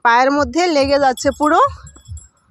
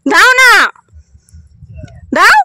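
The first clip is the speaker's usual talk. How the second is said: insistent coaxing; 8 semitones higher, 6 dB louder than usual. Real, not acted. Parent asking child for a kiss, high insistent coaxing. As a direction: urge the little one loudly, pitched up.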